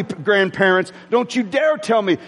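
ordinary delivery

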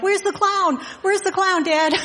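high, eager mock child voice; excited kids. Two boys asking their dad, imitated high-pitched excitement. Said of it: "They're so excited".

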